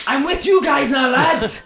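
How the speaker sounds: high, loud, slow proclamation; excited mock shout. Demoted player bouncing in to reserves, excited shout. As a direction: announce it with huge glee.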